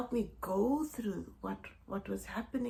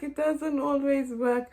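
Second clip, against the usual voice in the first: amused; 4 semitones higher, 5 dB louder, slower than usual. Real, not acted. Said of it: "trying to read my notes".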